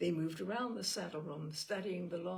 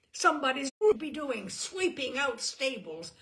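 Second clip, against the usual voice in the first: loud sneering furious tirade; contemptuous rage; 6 semitones higher, 8 dB louder than usual. A narrator's reading. Simon insulting Brat in a drunken rage. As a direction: pour scorn on him at full volume.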